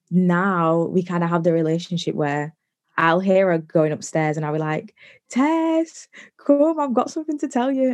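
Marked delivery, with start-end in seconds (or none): 5.3-7.9 high, singsong call to Tess. Retold or imitated. Calling her stepmom Tess in to hear gossip, high and eager.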